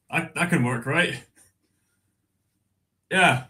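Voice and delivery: ordinary talk, nothing marked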